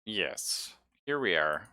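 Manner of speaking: ordinary talk, nothing marked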